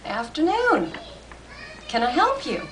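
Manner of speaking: a hint of a friendly old salesman; old shopkeeper. A narrator's reading. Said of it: "the old gentleman ... ambled to her".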